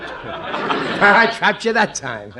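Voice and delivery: slow, chuckling triumph; gloating laugh. Acted. Husband gloating to wife after trapping her, laughing. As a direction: savour the win through laughter.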